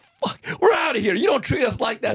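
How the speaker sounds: high, furious shout of a fighter walking out; angry champ. Retold or imitated. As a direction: bark it out, offended and done.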